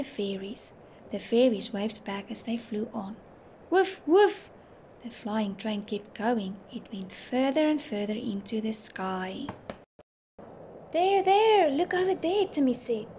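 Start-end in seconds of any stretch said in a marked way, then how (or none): 3.7-4.5 two high, loud puffs; imitated train chuffing. A narrator's reading. Train keeps flying past fairyland, high loud chuffing. 10.9-12.5 high, louder pointing-out cry; excited call. A narrator's reading. Timmy shows friends the stars, high excited call.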